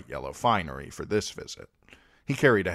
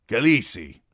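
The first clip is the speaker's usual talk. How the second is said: Jhogo's call; 5 semitones higher, 8 dB louder than usual. A narrator's reading. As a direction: call out Khaleesi with a stretched falling KHA-lee-see. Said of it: "said Jogo".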